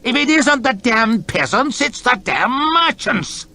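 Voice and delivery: angry roar. Acted. Lord chamberlain roaring at the soldiers by the door. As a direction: bellow your frustration. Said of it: "he roared at the soldiers".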